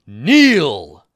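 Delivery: booming order to kneel; commanding shout. Acted. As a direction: shout it at the whole room like a guard. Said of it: "to get everyone's attention".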